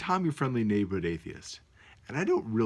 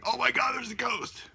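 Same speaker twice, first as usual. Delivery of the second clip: much higher, hammed-up panic; mock ghost panic, pitched way up; mock freaking out; 13 semitones higher than usual. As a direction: parody a scared believer. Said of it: "freaking out".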